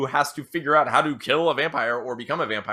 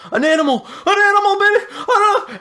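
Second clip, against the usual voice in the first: high, loud, huffing cries; frantic high-pitched victim, puffing and hollering; panicked out-of-breath shout; 9 dB louder than usual. Acted. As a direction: yell it in winded panic. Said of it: "he's just huffing and out of breath".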